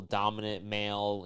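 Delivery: ordinary delivery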